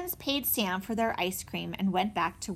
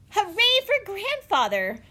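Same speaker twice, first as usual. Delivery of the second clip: high, loud, drawn-out cheer; bursting out; 12 semitones higher, 6 dB louder than usual. A narrator's reading. Benny cheers grandfather for not selling, bursting out. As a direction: let out a jubilant hooray. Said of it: "Benny burst out".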